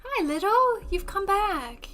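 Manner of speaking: high, cooing pet voice; pet-talk greeting. Real, not acted. Streamer greeting a returning pet, high cooing.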